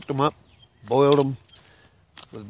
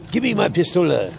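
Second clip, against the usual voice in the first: higher-pitched mock French accent; 6 semitones higher, 4 dB louder than usual. Frenchman asking for his weapon, in a mock French accent. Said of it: "So they go to the Frenchman".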